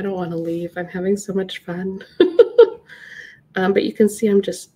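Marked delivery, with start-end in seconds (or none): 2.1-3.3 squealing giggle; high delighted laugh. Real, not acted. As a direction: burst into a high happy laugh. Delighted at having fun.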